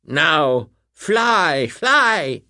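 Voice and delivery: high, loud witch command; witch crying out. A narrator's reading. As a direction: cry out to the monkeys, high and loud. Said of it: "she cried".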